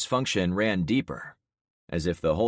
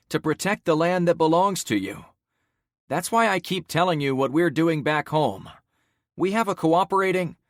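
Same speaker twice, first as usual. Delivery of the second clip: firm, raised persuading voice; rallying speech; 9 semitones higher, 6 dB louder than usual. Acted. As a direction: win the crowd over. Protagonist rallies crowd.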